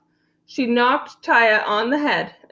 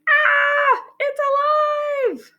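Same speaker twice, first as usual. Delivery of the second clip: very high shriek of alarm; high-pitched panicked cry; scream. A narrator's reading. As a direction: scream in comic terror. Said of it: "The lady screamed".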